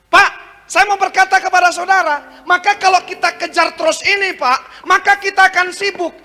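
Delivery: high-pitched, urgent sermon delivery; impassioned preaching. Real, not acted. Preacher warning the congregation against chasing titles, impassioned. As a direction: preach it high, drawing out the final word.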